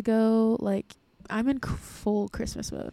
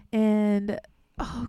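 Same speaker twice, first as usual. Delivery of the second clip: ordinary talk, nothing marked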